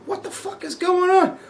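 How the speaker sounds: very high, soft incredulous squeak; mock bewilderment. Asking a coworker about office people working, high-pitched bewilderment. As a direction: squeak the question in hushed disbelief.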